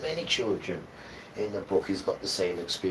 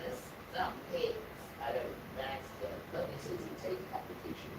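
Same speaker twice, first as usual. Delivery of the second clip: whispered aside; 4 semitones higher, 10 dB quieter than usual. Real, not acted. Unvoiced aside.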